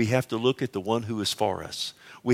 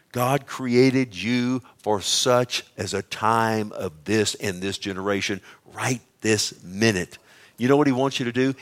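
ordinary delivery

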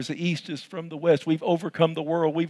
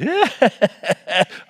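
high delighted laugh; real laugh; 9 semitones higher, 5 dB louder than usual. Real, not acted. Pastor laughing at his own joke, delighted laugh. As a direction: burst out laughing.

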